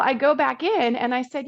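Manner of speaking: ordinary talk, nothing marked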